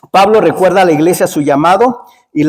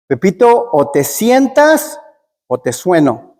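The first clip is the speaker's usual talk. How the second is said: imitated mother's warning; much slower than usual. Mamá threatens Pepito at the table, mock stern warning. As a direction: stretch the choice, then drop for the threat. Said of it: "la mamá le dice".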